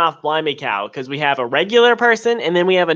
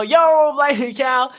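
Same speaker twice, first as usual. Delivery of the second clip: playful yo greeting; 7 semitones higher than usual. Acted. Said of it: "a thing that Ryan and I both do".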